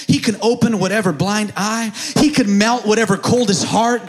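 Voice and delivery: loud, driving, climbing sermon delivery; full-force preaching. Real, not acted. Preacher promising the church God's power, full-force preaching. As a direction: pile up each promise, rising into a high shout.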